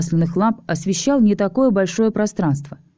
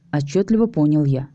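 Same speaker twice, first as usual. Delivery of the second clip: ordinary delivery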